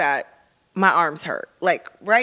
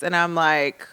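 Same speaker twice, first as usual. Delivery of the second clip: ordinary talk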